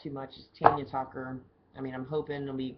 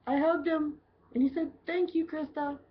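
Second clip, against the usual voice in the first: high, louder giddy gush; giddy excitement; 4 dB louder than usual. Real, not acted. Bartender gushing about hugging a celebrity, high giddy voice. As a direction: gush like a starstruck fan. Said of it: "excited retelling".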